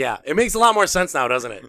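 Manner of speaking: mocking know-it-all reply to dad; sarcastic retort. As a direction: needle him with a knowing sneer.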